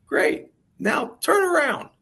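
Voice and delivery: a hammed-up high mentor voice coaching a player; imitating Ken's voice. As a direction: play the old mentor in a high put-on voice. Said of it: "Ken's got this great voice".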